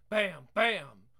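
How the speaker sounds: booming bam bam, spoken loud; loud knocking sound. Acted. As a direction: pound it out like fists on a door. Knocking at the door again.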